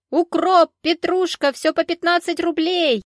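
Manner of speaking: high, loud, piercing market call; piercing hawker's cry. A narrator's reading. Greens seller to the whole market, piercing high hawking. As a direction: cry your wares so shoppers jump. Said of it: "Голос был настолько пронзительным и высоким".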